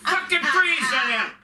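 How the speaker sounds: hammed-up outburst of a cranky old woman; mock snapping old mother. Son imitating his mother snapping at him over the blanket. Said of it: "She'd go".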